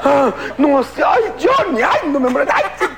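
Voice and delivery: hammed-up moaning. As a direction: overplay the lover's moans. Amantes haciendo el amor. (gemidos exagerados, muy agudos)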